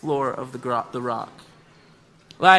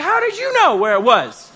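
higher, louder disbelief at the guide; indignant questioning; 7 semitones higher, 5 dB louder than usual. Retold or imitated. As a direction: demand answers in mock outrage. Outraged questioning about the cliff.

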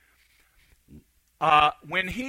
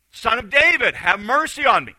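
raised, insistent call for mercy; louder cry; 4 semitones higher, 7 dB louder than usual. Retold or imitated. Blind beggar calling over the crowd to Jesus, louder cry. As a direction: yell louder to get his attention. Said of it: "he starts getting louder and louder and louder".

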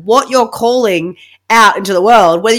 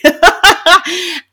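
bright higher laugh; 9 semitones higher, 4 dB louder than usual. Real, not acted. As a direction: let out a real laugh. Laughing at mum's saying.

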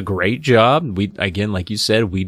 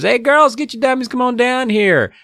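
high-pitched mock holler upstairs; imitated yell to another floor; 14 semitones higher than usual. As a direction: call the girls down in a high put-on voice. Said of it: "So he yells upstairs".

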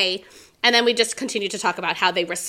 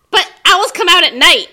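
high, loud mock-children's objection; protesting kids; 8 semitones higher, 10 dB louder than usual. Teacher imitates kids objecting to owl story, high and loud. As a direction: pipe up like pupils catching a mistake. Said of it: "all the kids were like".

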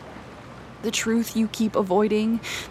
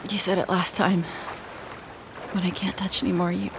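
ordinary talk

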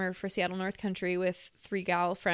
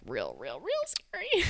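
playful spooky emphasis; slower than usual. Pretend. Mocks how scary the story is.

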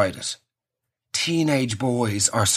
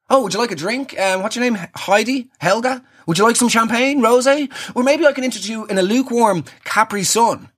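lightly acted, higher-pitched polite offer; awkward teenage host; 8 semitones higher than usual. Boy offers a model drinks, lightly mock-polite. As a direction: play a hint of a boy trying to host.

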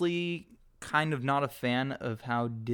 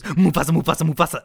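louder, giddy repeated name; hyena impression; 3 semitones higher, 11 dB louder, faster than usual. Hyenas thrilling each other with the name, mock hyena. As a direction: relish saying it like a thrill-seeking hyena. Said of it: "And he goes".

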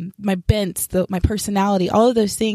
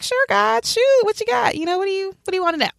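eager reply; 12 semitones higher than usual. Retold or imitated. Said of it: "I was like".

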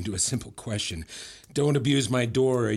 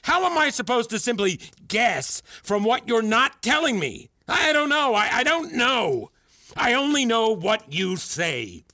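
worked-up tirade; 12 semitones higher, 3 dB louder than usual. Acted. Mentor accusing student of expecting mind-reading, agitated tirade. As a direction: voice pitched way up in agitation.

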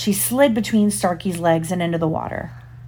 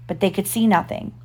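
plain reading voice

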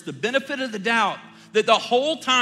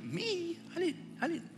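taken aback; 10 semitones higher, 11 dB quieter than usual. Retold or imitated. Dad stunned she is mad.

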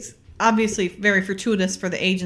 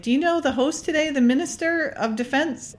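a lightly voiced diplomat, pitched higher; a hint of a grand host, pitched up; imitating the diplomat; 8 semitones higher than usual. Podcaster retelling the diplomat's party chatter, lightly mimicked higher.

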